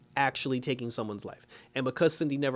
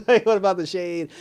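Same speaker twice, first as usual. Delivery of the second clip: laughing; 6 semitones higher, 7 dB louder than usual. Retold or imitated. Mocking the character's outraged question.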